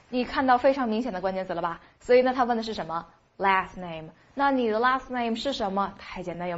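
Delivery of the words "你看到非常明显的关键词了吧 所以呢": ordinary talk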